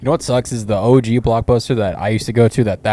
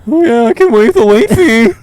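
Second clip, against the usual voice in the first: loud, high, laughing nerd impression; hammed-up starstruck dork; imitated dork; 12 semitones higher, 8 dB louder than usual. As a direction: blurt it like an overeager clerk. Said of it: "that dork's like".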